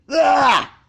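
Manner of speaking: sudden high, loud startled cry; high yelp of surprise, waking to a face too close; startled blurt. A narrator's reading. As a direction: jolt back with a yelp at the face. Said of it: "he blurted".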